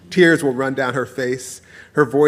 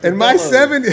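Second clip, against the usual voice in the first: real laughter taking over, high and loud; high voice collapsing into laughter; cracking up; 13 semitones higher, 4 dB louder than usual. Real, not acted. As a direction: try to go on but burst out laughing. Cracks up after the punchline.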